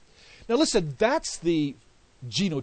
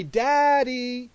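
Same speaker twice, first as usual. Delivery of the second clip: high, louder sing-song coaxing daddy; a mother coaxing a baby, drawn out; mother repeating daddy to her baby, high and coaxing; 9 semitones higher, 7 dB louder than usual. Retold or imitated. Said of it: "she would say".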